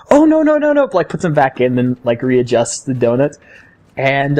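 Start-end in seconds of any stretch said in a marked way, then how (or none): 0.1-0.9 high, louder mock mother stopping her kids; higher mock-parent no no no; imitating the mom. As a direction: hurriedly stop the kids like their mom. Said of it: "is like".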